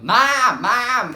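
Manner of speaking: high, very loud shout; yelling for Mom. Retold or imitated. Kid yelling at mom who won't reply, shouting. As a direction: yell it as if she can't hear you. Calling louder as mother ignores her.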